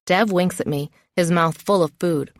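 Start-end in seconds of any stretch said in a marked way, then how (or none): none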